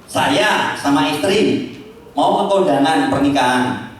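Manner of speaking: usual speaking voice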